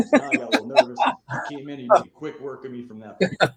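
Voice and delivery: loud laughing through the words; real laughter telling the beating. Real, not acted. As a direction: crack up mid-sentence.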